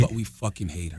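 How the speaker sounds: confiding hush. Retold or imitated. Bank worker quietly confiding about the nutty regular. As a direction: lean in and share a secret. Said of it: "she goes".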